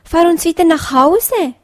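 whiny demand to go home; protesting plea. A narrator's reading. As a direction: fox cubs begging the bunny to drive them home. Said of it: "riefen die Füchse".

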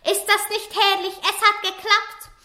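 high, delighted exclaim; thrilled master. Acted. Master tells wife it worked, high and delighted. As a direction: crow about the good news.

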